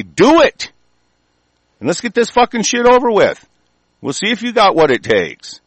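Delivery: daring the authorities to come; defiant shout. Real, not acted. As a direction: yell the challenge at them. Angry rant, challenging the government.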